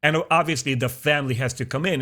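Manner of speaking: usual speaking voice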